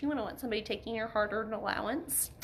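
usual speaking voice

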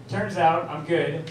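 usual speaking voice

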